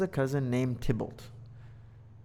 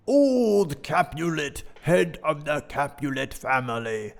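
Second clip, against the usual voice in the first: put-on booming old Capulet; 2 semitones higher, 5 dB louder than usual. Teacher booming old Capulet's name for the class. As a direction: announce him like a big gruff chieftain. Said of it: "he would talk like that".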